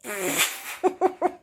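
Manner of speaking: high, breathy burst of real laughter; happy giggle at the fifty-dollar surprise; delighted laugh. Real, not acted. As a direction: laugh out the joy of the win. Laughing over the windfall.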